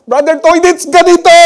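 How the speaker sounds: high, loud cry from the back of the hall; retold shout. Lady at the back shouting to the pastor, loud and high. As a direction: call out to the preacher from the back. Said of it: "Sumigaw siya while standing up".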